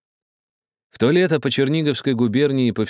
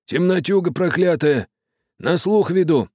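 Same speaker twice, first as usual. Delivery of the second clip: high, grumbling curse at the dark; steersman cursing; 11 semitones higher, 3 dB louder than usual. A narrator's reading. Steersman cursing the night, mildly irritated. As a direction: curse the darkness. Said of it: "мрачно проворчал рулевой".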